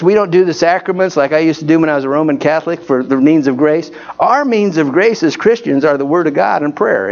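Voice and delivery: ordinary talk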